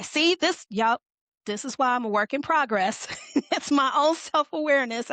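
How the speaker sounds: ordinary talk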